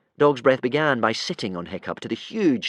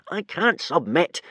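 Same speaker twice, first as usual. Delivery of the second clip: higher, slow indignant protest; 8 semitones higher, 3 dB louder, slower than usual. A narrator's reading. Hiccup refusing to submit, indignant. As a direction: refuse with wounded pride. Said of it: "said Hiccup indignantly".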